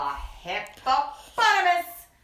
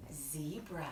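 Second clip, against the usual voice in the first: ordinary delivery, nothing marked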